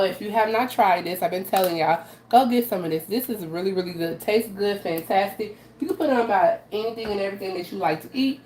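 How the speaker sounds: ordinary delivery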